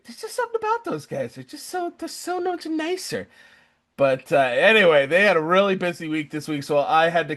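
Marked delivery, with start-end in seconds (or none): 0.0-3.3 much higher, soft, fast mock swoon over "those guys"; mock gushing. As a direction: gush like a smitten fan, pitched way up. Gushing about Australian guys.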